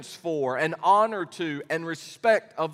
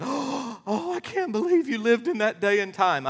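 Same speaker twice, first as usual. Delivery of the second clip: higher, imitating a shocked listener; light hammed-up astonishment; mock disbelief; 5 semitones higher than usual. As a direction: tease the congregation's disbelief. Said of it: "some of you are going".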